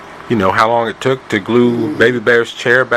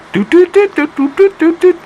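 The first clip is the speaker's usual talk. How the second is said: high beeping ring tone; phone ringing sound; 5 dB louder than usual. Acted. Phone ringing in told story.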